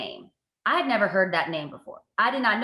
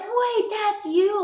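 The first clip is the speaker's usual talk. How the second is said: surprised; 13 semitones higher, much slower than usual. Retold or imitated. Friends recognizing her, surprised.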